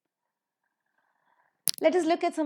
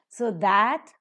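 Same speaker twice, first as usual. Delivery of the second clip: that said louder with a rise; strong form of that; 10 dB louder than usual. Shown as a demonstration. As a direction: give that its full vowel, stressed. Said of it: "is a strong form".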